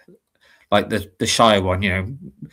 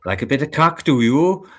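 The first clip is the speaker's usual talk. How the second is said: cheeky Welsh catchphrase, pitched up at the end; Welsh accent; 4 semitones higher, 4 dB louder than usual. Shown as a demonstration. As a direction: slip into a lilting Welsh accent. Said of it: "then it sort of goes into it".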